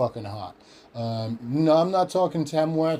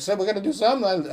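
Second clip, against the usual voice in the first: 1 frantic high voice; 7 semitones higher, much faster than usual. Retold or imitated.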